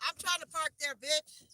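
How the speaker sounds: loud, high, angry yell; furious shout. Real, not acted. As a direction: scream it at the other driver. Yelling at a car taking her spot.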